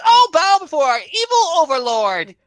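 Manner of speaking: mock worshipper.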